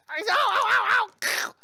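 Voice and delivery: high squeaky ows fading to a whisper; mock ow cries. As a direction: yelp like you got bitten. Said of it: "And then Nemo's like".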